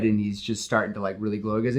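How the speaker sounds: ordinary talk, nothing marked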